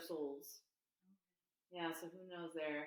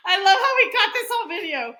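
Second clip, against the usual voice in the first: loud, high laugh through words; cracking up mid-sentence; laughing hard; 12 semitones higher, 21 dB louder than usual. Real, not acted. Laughing through a leg cramp.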